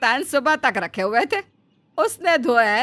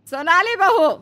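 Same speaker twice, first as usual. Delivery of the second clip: louder, higher repeat call, falling; 8 semitones higher, 6 dB louder than usual. Acted. Mother-in-law calls the bahu a second time, insistent. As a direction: call again more insistently.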